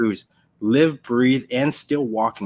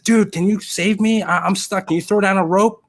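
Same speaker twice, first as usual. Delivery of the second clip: high, loud desperate plea; pleading for rescue; 9 semitones higher, 4 dB louder than usual. Acted. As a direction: beg your friend to get you out. Man pleads from the hole.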